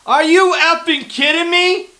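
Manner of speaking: loud, high, furious shouting at the refs; furious yelling. Real, not acted. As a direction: yell it out, outraged at a bad call. Fan furious at referee's flag.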